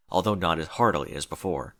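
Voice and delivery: ordinary delivery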